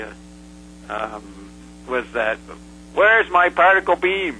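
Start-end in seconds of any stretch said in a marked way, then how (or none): 2.9-4.3 high, loud demanding cry; retold yell. Tesla speaking through Paul at the session, yelling. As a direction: imitate a channelled spirit yelling out indignantly. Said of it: "he yells out".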